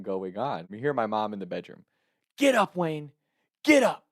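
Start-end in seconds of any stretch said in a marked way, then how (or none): 2.4-4.0 mother ordering her son out of bed; mom yelling. Retold or imitated. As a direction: bark it like a fed-up mom.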